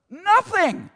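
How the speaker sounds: nothing rising sharply high; soaring emphatic nothing. Real, not acted. Preacher insisting grace costs nothing, high emphatic cry. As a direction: throw nothing up high, amazed.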